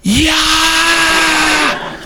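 high-pitched lunatic crowd scream; long, held mock shriek; imitated crowd scream. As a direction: scream like a rabid fight crowd. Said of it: "lunatics all screaming".

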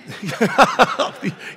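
loud, high burst of laughter; laughing. Real, not acted. Preacher amused at an over-eager amen, laughing. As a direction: crack up out loud. Teases the audience after their amen.

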